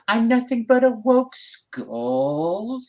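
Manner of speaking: put-on high, drawn-out Valley Girl; ditzy Valley Girl impression. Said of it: "with my Valley Girl tone".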